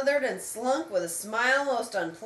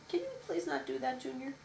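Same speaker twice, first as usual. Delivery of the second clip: quiet aside; 8 dB quieter, faster than usual. Real, not acted. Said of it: "aside to child".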